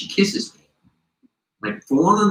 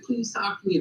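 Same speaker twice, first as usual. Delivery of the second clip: high, soft imitated plea; lightly voiced drunk girl, pitched up; drunk pleading; 7 semitones higher, 6 dB quieter than usual. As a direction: imitate a tipsy girl begging softly. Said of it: "like, inebriated".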